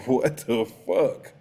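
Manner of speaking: "what the fuck" stretched out slowly in disbelief; exasperated disbelief. Acted. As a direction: lean slowly on each word of "what the fuck". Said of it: "I just really be like".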